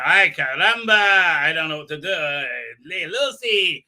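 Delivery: loud, hammed-up helpless wail; put-on comic exclamation of confusion; mock bewilderment. Cartoonish acted bewildered character.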